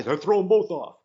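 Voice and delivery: high, loud mock yell; imitated heckler's shout. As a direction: call it out like an angry heckler. Re-enacts the heckler's call.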